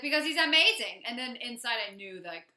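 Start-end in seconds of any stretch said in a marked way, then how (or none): 0.0-1.0 louder, higher insistence; mock defensive gushing. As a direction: imitate a girlfriend loudly defending her boyfriend. Retelling her defence of boyfriend.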